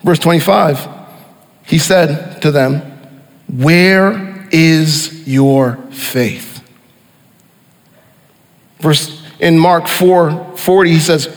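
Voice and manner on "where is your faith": a tender, drawn-out question of faith; gentle rebuke. Acted. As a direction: ask it very slowly, gently chiding. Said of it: "he gently rebukes the disciples".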